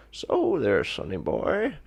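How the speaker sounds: lightly voiced old curmudgeon; a hint of a grumpy old man. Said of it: "old curmudgeonly man who walks out".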